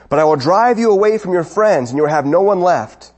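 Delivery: loud, insistent mock threat; threatening emperor. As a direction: imitate an emperor escalating his threats. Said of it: "the emperor threatened him".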